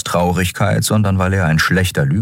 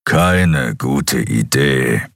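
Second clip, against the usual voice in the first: very slow, drawn-out grumble; grumbling creature; 3 semitones lower, much slower than usual. Acted. Skrilla disapproves of the new crew member, grumbling. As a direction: grumble it low, stretching each word. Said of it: "brummte der Skrilla".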